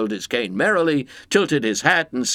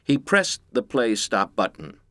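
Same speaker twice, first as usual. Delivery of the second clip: ordinary delivery, nothing marked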